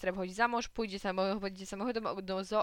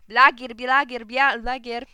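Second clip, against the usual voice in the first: loud, jeering chant of the insult; mocking shout; 2 semitones higher, 14 dB louder, slower than usual. Rufus taunts Maksencjusz with a loud mocking yell. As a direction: yell the insult to provoke him. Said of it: "powtórzył Rufus".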